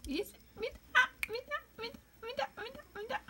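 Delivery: very high, soft coaxing repeats; squeaky baby voice saying "me dá"; baby talk to pet. Acted. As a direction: tease the bird to give it up. Playing tug with the bird.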